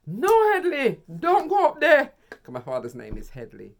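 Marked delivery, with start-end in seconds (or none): 0.1-2.1 loud, high frantic shout; yelling from upstairs. Retold or imitated. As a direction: yell it to stop him going upstairs. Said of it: "next minute me hear".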